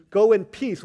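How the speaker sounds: loud ringing pronouncement of Go in peace; proclaimed blessing. Acted. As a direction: declare it over the crowd. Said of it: "He said".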